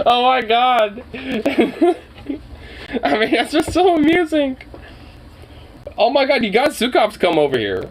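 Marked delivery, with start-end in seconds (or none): 0.1-4.6 laughing hard, high-pitched; laughing while talking. Real, not acted. Laughing at dog.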